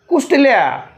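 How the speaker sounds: usual speaking voice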